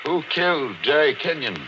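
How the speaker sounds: high, loud, desperate command; shouted demand. Acted. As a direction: shout at the record, high and strained. Demanding answer from the record.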